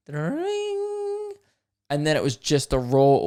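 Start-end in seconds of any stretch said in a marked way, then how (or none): 0.1-1.3 a high, piercing mock phone ring; imitated bell ring. Copying the fan's sound effect at the start as a high ring. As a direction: squeak out a quick ringtone noise. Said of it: "a sound effect at the start that went like".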